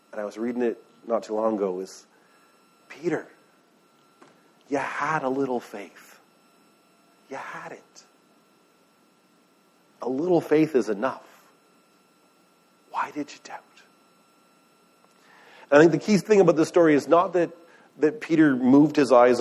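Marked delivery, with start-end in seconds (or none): 2.9-13.6 soft, tender rebuke, dropping to a hush; gentle Jesus. Retold or imitated. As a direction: gently reassure Peter, almost whispering the last question. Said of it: "the way that I hear it".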